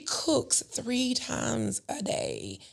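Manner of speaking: awed stage whisper; amazed whisper. Real, not acted. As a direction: whisper it like you can't believe it. Marvelling at sister's routine.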